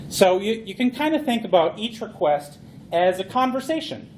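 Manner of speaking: ordinary delivery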